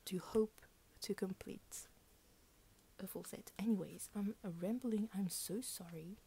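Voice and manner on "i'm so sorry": normal talking voice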